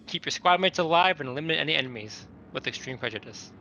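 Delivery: ordinary talk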